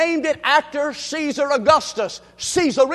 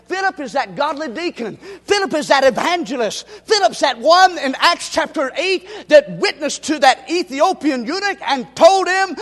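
ordinary delivery

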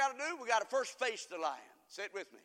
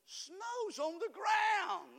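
drawn-out preaching shout; 10 semitones higher, much slower than usual. Acted. Preacher calling the answer back to the congregation, slow and high. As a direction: stretch it out and call it high.

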